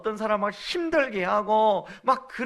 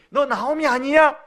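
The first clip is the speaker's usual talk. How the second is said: louder, sneering question; mocking; 6 semitones higher, 6 dB louder than usual. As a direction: make the greeting sound like a taunt. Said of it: "조롱하듯 들리는 거예요". (조롱하는 말투)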